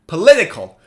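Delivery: "Political" in one go, LIT punched loud; quick "Political" peaking on the second syllable. Shown as a demonstration. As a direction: hit LIT in a joined-up "Political". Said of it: "Now say it faster".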